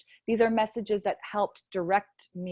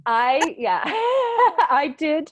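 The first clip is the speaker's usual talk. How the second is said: higher, louder, laughing through the words; real laughter; 5 semitones higher, 5 dB louder, much slower than usual. Real, not acted. Author amused by question on receiving messages, laughing as she answers. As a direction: answer while breaking into a laugh.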